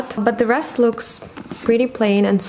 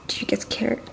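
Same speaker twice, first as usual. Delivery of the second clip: whisper; 5 semitones higher, faster than usual. Real, not acted. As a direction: hush to the startled cat. Whispering to startled cat.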